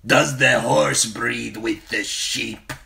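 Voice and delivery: slow, loud, sneering warrior jeer; crude rider mocking. Crude blood rider mocks the queen, loud slow sneer. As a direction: jeer at her like a brute.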